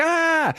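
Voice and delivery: short high-pitched acted scream; mock scream. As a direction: shriek at a horror, hammed-up. Jess screams at zombie reflection.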